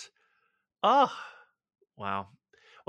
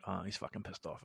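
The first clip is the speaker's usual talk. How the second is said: softer, muttered worry; worried thought; 6 semitones lower, 13 dB quieter than usual. Retold or imitated. Fearing the friend is angry, muttered. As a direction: say it under your breath. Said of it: "I'm like".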